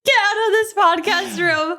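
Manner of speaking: shrieking with laughter; high, loud laughing tease. Real, not acted. Teasing after anchor bit.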